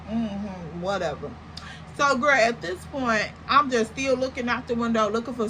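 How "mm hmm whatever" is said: low, soft brush-off; dismissive. Retold or imitated. Woman brushes off the man's claim he's cool, dismissive. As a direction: drop it away, unconvinced. Storyteller brushes off his answer.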